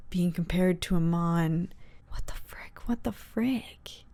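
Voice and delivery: hushed disbelief under the breath; whispering to herself in shock; stunned whisper. Real, not acted. Viewer whispering to herself, stunned.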